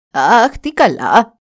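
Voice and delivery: slow, drawn-out delighted exclamation; old woman exclaims in delight at the news; exclaiming. Acted. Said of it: "αναφώνησε η γριά".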